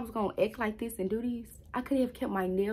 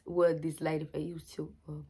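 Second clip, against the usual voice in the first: ordinary delivery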